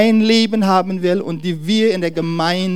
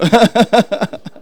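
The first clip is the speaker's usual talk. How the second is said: loud, higher burst of laughter; hearty laugh; 5 semitones higher, 6 dB louder than usual. Real, not acted. Preacher calling all that the intro, hearty laugh. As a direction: laugh out at your own joke.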